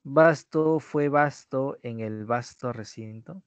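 slow, every word laid out; slow careful reading. Shown as a demonstration. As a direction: read it slowly for dictation. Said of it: "Vasco, perdón".

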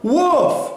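high imitated dog woof; dog's woof. A narrator's reading. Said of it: "said Ebb to the baby seal".

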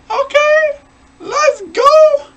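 very high squeaky voice; squeaky high okay. Real, not acted.

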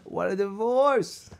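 high, stretched-out holler; mock yell. Man cuts off girlfriend's objection, mock yell. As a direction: draw "divorce" out high, hollering. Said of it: "I'll yell it loud too".

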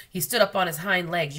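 ordinary delivery

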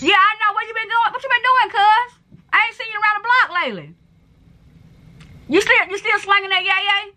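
high, pushy street greeting; mistaken for angry. Real, not acted. Pressing a stranger on where they've been, high and insistent. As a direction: fire the questions out high and insistent. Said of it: "Why you angry?".

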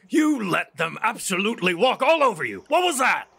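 furious father shouting muffled behind a door. Acted. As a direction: bellow it at your daughter. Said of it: "is kind of shouting out".

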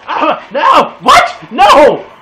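very high, loud startled cry; shocked yell. Real, not acted. Gamer shrieks in disbelief when killed, very high and loud.

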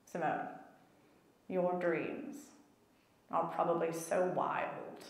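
a hint of a low, soft stoic British voice; stoic British mentor. Mentor in a voice note to Simone, a low, quiet stoic British voice. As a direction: lightly voice the calm, dry mentor. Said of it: "typical stoic British guy".

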